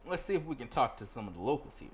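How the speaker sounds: usual speaking voice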